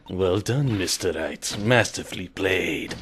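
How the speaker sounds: low, soft, slow villain purr; hushed, drawn-out mastermind congratulations; evil Bond villain. Acted. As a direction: play a quietly menacing Bond villain. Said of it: "evil Bond villain accent".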